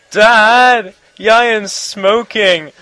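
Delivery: loud, high call to dad, drawn out; shouted tattling. Retold or imitated. Kid shouting to his dad that his friend smokes, loud and drawn out. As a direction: yell out to dad to get a friend in trouble. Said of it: "I shouted to my dad".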